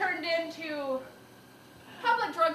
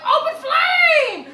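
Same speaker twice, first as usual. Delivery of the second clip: high shouted alarm; 12 semitones higher, 12 dB louder, much slower than usual. Retold or imitated. Retelling her park shout, loud and high. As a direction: yell it out slowly. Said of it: "And I'm just like".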